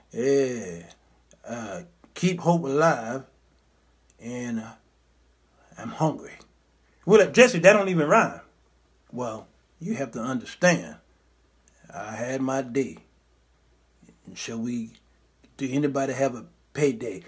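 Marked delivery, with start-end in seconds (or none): 0.1-6.3 low, soft, halting mumble; drowsy mock orator with long pauses; Jesse. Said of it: "he talks like this". 9.1-17.2 low, soft, halting mumble; drowsy mock orator with long pauses; Jesse. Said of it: "he talks like this".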